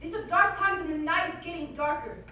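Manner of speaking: loud, cackling confession; evil queen. Acted. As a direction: gloat over the deed.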